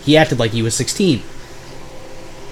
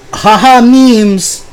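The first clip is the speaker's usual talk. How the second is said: much higher, louder goofy mock laugh-talk; mocking Vander; 10 semitones higher, 6 dB louder, much slower than usual. Imitating his roommate's stale meme jokes, high and goofy. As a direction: parody a cringey meme guy.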